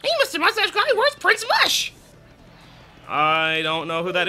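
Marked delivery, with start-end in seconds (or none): 0.0-1.9 put-on high, chirpy kid voice; character voice. Gamer voicing a child asking Mario, high and chirpy. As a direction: call out like an eager child.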